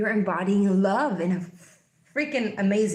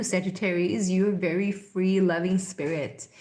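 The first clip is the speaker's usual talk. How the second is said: normal talking voice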